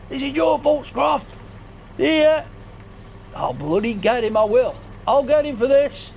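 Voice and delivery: hammed-up angry chase; high shouting character voice. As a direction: yell after him as you run. Character chasing Scruff, shouting.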